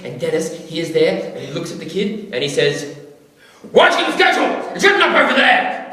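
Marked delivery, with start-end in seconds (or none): 3.7-5.9 put-on barked high and loud at the kid; aggressive shout. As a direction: snap at him like an angry camp counsellor. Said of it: "Super aggressive".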